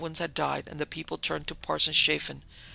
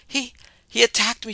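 higher and louder, a stammering distressed moan; 7 semitones higher, 8 dB louder than usual. A narrator's reading. Lucia to the men in the rain, moaning in distress. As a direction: make them believe she was hurt. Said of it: "she moaned".

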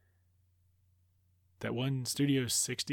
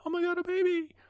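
much higher squeal of delight; gushing over a baby; 3 dB louder than usual. Retold or imitated. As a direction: coo like you've just seen your first baby. Diana sees a baby, quoted again.

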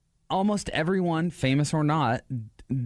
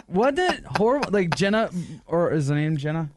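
usual speaking voice

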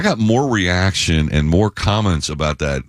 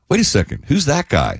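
surprised; 7 semitones higher than usual. Retold or imitated. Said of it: "and you're like".

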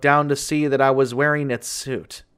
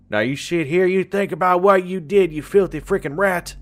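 put-on angry older man snapping at a boy, with an unplaced accent; older man yelling; 6 semitones higher than usual. A narrator's reading. Older man yelling at the boy he locked in. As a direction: bark it like a harsh guardian. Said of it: "an older man yell at me".

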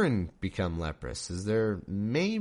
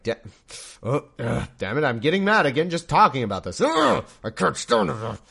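God getting worked up and louder, grumbling in frustration; mock angry God; 3 semitones higher, 6 dB louder than usual. God loses his temper while telling Moses, mock angry. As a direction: huff and fume, half joking. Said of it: "and then he was like".

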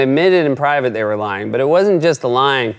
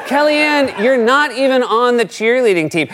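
mock reporter; 6 semitones higher than usual. Reporter retorts in skit.

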